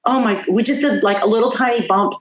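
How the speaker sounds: usual speaking voice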